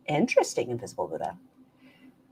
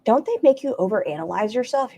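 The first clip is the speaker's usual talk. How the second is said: normal talking voice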